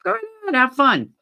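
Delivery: a lightly voiced sarcastic dare; daring go-ahead. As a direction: egg them on, high and mocking. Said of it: "I said".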